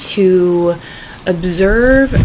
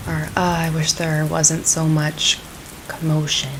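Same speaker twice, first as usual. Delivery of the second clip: calm, unhurried meditation voice; guided meditation; 9 dB quieter than usual. Acted. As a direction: ease them gently into the practice.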